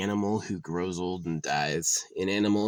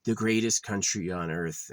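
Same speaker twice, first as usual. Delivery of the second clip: mocking smug patriot; 5 semitones higher than usual. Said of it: "they go like".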